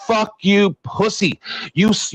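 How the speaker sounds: ordinary delivery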